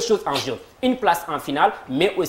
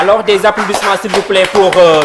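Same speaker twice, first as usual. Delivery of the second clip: much louder, rallying the studio; calling for applause; 3 semitones higher, 9 dB louder than usual. Real, not acted. Host asks the audience to applaud the teams, loud and rousing. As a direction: whip up the crowd for both teams.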